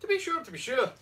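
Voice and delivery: high-pitched comic Irish catchphrase; mock Irish accent. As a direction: play up the Irish lilt. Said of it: "say it with an Irish accent".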